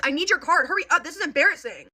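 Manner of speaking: high, loud bratty demand; rudest tone. Retold or imitated. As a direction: imitate an impatient kid ordering mom around. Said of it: "In, like, the rudest tone ever".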